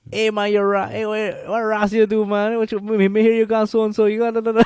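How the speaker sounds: high, loud Guyanese banter; Guyanese accent. Retold or imitated. Guyanese man greeting a friend with loud teasing, high and loud. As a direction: shout it like a Guyanese uncle ribbing a friend. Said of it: "I would say more like".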